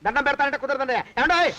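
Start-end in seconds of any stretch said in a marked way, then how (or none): 1.2-1.5 sudden raised call. Acted. Creditor pressing the master for payment, calling out loudly. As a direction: holler to make him squirm. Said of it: "అరవకురా".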